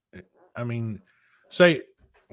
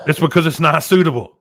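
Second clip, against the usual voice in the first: loud, higher-pitched emphatic objection; worked-up, forceful rant; 7 semitones higher, 10 dB louder than usual. Real, not acted. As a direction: push it out like the start of a rant. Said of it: "just a bit of a rant".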